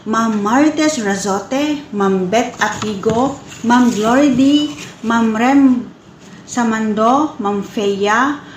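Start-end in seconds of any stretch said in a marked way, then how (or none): none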